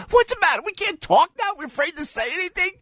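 very high, baffled outburst; incredulous protest. Retold or imitated. Host reacting to whispering fan, incredulous high voice. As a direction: challenge the secrecy in high-pitched disbelief.

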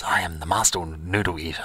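deep, soft, solemn boast; master noodle eater. Retold or imitated. Noodle champion declaring his title to the dreamer, deep and solemn. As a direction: drop way down and announce it gravely. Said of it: "he goes".

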